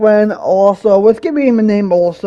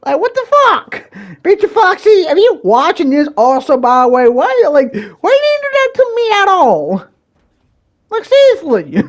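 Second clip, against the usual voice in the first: high-pitched, exasperated demand for answers; indignant outburst; 10 semitones higher, 4 dB louder than usual. Real, not acted. Confronting an ex who may be watching, indignant. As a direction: voice jumps way up in protest.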